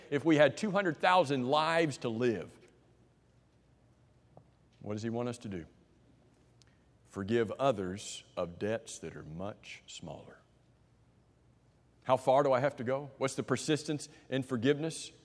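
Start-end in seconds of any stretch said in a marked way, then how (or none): 4.8-10.4 whisper. Real, not acted. Preacher drops to a whisper for the key point.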